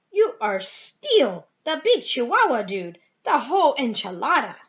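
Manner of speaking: loud, hammed-up Spanish accent. A narrator's reading. Kitty boy addresses his mirror image in a showy Spanish accent. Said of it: "using his very best Spanish accent".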